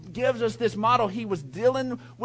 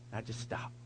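barely voiced hush while hiding; 9 semitones lower, 15 dB quieter than usual. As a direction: breathe it out like you're hiding under the bed. Hiding under bed, holding still.

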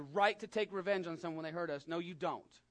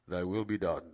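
louder, lower, formal scripture voice; solemn King James intoning; 9 semitones lower, 8 dB louder than usual. Shown as a demonstration. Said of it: "we have to even do it like this".

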